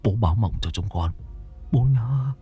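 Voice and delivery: low, soft murmured plea to a dead father; hushed fearful prayer. Acted. Son praying to his dead father, hushed and fearful. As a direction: whisper a nervous prayer at the altar.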